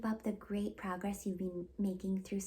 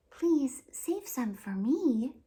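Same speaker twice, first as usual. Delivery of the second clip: hopeful witch; 4 semitones higher, 6 dB louder, much slower than usual. Acted. Willow asks children to share.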